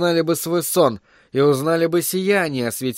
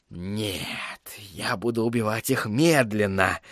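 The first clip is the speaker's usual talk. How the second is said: low, hushed threat; menacing whisper; 4 semitones lower, 7 dB quieter, slower than usual. A narrator's reading. Sorcerer vowing revenge on traitors, menacing and hushed. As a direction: drop to a soft, sinister near-whisper.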